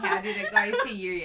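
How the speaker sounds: high, loud, laughing through the words; giggly mock-innocent question. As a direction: ask it while cracking up. Friend asks, laughing, about the night.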